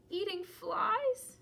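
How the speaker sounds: dread. Acted. Gerald imagines becoming a frog.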